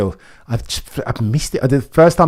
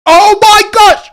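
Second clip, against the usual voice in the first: very high, loud, drawn-out shriek; panicked scream; 13 dB louder, slower than usual. Real, not acted. Max fan screaming as George attacks Max. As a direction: scream it slowly in pure panic. Rivals about to collide on track.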